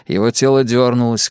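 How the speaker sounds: ordinary delivery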